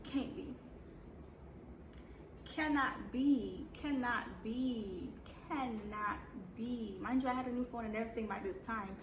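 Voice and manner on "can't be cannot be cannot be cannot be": slow, stunned "cannot be"; slow disbelief. Retold or imitated. To herself on seeing her ex viewed her stories, slow stunned disbelief. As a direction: stretch out each "Cannot" in disbelief. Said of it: "I'm just like".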